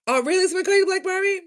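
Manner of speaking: very high, loud exclaimed question; loud surprised reaction. Real, not acted.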